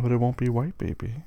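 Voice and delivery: ordinary talk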